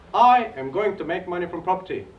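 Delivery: loud chanted affirmation. Shown as a demonstration. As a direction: declare it to the room like a pledge. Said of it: "We're gonna repeat this five times".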